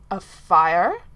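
hearing of a fire, shocked. A narrator's reading. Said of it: "Sir Ellis sounded shocked".

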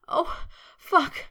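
high breathy wail; wailed cry. A narrator's reading. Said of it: "he wailed".

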